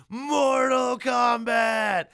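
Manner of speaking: long, high, full-voiced title shout; drawn-out battle-cry bellow; theme-song yell. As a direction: holler it like the famous song hook. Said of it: "And then they know the song".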